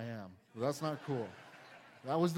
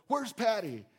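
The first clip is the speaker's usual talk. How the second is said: calling out; 5 semitones higher, 7 dB louder than usual. Retold or imitated.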